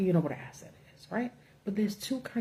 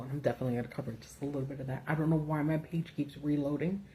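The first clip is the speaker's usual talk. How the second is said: ordinary talk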